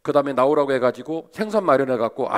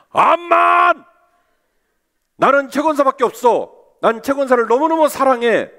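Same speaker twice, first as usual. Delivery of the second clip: much higher, louder, gushing "of course!"; mock husband gushing his love; eager declaration; 10 semitones higher, 7 dB louder than usual. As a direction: burst out with a hammed-up love vow. Elder's ideal eager reply.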